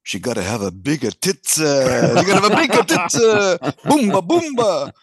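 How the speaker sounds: the Pope relishing the punchline in a put-on accent; mock accent Pope. Pope stating his last condition, high and loud in a mock accent. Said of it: "I'm gonna do an accent too".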